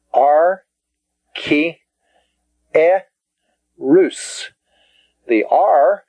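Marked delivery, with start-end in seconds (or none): 0.1-4.5 ar, ki, e, rus each spoken apart and higher; syllable by syllable. Shown as a demonstration. Teacher dividing the word for students. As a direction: spell out the syllables. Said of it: "The next word".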